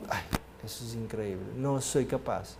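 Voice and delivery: low, very soft self-doubt; acted reluctance. Someone dreading to confess a deception, acted hushed reluctance. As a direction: murmur it as if losing the nerve.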